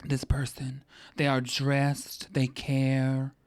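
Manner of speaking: put-on lightly done Natasha-like creepy accent, slow; creepy lady voice. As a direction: purr it like a creepy Eastern European lady. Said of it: "that's how creepy women talk to me".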